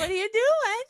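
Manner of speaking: very high, drawn-out eerie tone; spooky stranger calling out; high slow creepy voice. Retold or imitated. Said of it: "I'm like".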